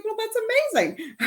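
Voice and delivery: high, fast, laughing exclamation; delighted laughing reply; laughing quote. Retold or imitated. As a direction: gush it with a laugh. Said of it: "And I was like".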